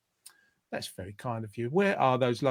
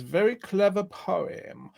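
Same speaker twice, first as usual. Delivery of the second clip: slow, stretched words with raised pitch; drawn-out reading; 4 semitones higher, slower than usual. Retold or imitated. Host reading a listener's comment, slowly drawn out. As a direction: linger over each word.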